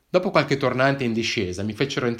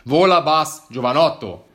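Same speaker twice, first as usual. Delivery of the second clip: louder, higher dialect grumble; gruff dialect cop; 4 semitones higher, 9 dB louder than usual. Acted. Policeman snaps back at the hiker in dialect, gruff. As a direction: brush off the old cop in dialect.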